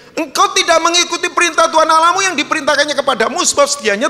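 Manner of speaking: very high, loud, rapid declamation; forceful scripture reading. Real, not acted. Preacher reads Samuel's rebuke to Saul, loud high declamation. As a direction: thunder the rebuke from the verse.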